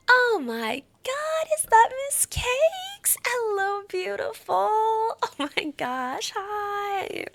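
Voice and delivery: high, drawn-out squeal of delight; gasp, then squeal hello slowly; loud, high fangirl greeting; gushing excited greeting. Real, not acted. Greeting a friend arriving in chat.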